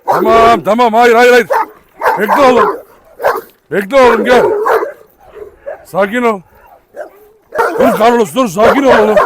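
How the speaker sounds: shouting firm commands; loud commands. Real, not acted. Owner shouting at fighting dogs to stop, loud.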